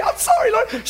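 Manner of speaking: imitated high-pitched tearful wail; hammed-up crying apology, way up high; mock bawling. Bawling wife apologising to the Lord, mock sobbing. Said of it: "she's bawling".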